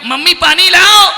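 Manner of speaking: high, loud call rising at the end; child calling out. Acted. Small child calls mummy for water, loud and demanding. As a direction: shout to mother for water.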